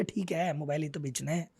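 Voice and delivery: low, soft, casual shrug of a quote; dismissive settling. Retold or imitated. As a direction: settle for it offhandedly, voice dropped. Voices a shopkeeper settling for less.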